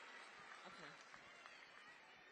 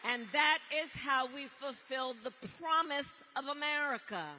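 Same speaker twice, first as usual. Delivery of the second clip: rally speech; 2 semitones higher, 8 dB louder than usual. Acted. Builds to rally line.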